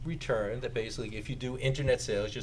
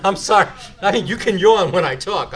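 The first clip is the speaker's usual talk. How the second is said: laughing apology; 6 semitones higher, 10 dB louder than usual. Real, not acted. Teasing a yawning audience member.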